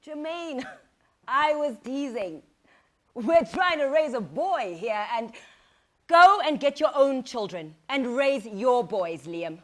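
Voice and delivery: loud, furious rant at him; angry shouting. Acted. Mother yelling at her husband Liam in anger.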